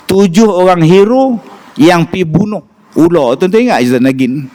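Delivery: ordinary talk, nothing marked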